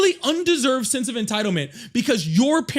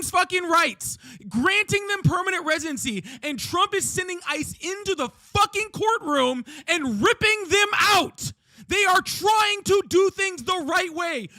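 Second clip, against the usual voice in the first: screaming in anger; 4 semitones higher than usual. Real, not acted. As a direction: yell it straight at the person you are arguing with. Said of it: "but you're screaming".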